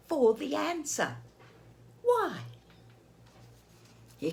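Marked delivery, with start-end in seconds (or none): 2.0-2.4 high little pig voice asking why; squeaky Piggy. Acted. Piggy pipes up to Gerald, high and eager. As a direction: pipe it up like an eager piglet.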